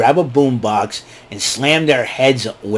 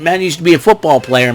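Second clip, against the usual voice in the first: normal talking voice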